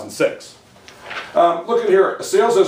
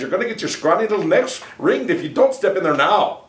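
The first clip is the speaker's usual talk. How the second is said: put-on Irish accent; 3 semitones higher than usual. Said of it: "a wee bit of the Irish".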